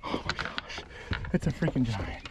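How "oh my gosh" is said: soft, breathless whisper; stunned whisper. Real, not acted. Angler overwhelmed by his catch, stunned whisper. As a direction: whisper it, spent from screaming. Overwhelmed after landing the giant.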